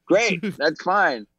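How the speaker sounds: high, drawn-out going along with it; mock acceptance. Actor humoring people who mishear his accent, mock acceptance.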